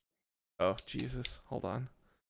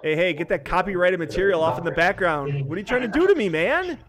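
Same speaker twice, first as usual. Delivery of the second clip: loud, higher-pitched scolding; loud protest; 4 semitones higher, 7 dB louder than usual. Real, not acted. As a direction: call out urgently, pitch climbing on the complaint. Scolds friend over background copyrighted audio.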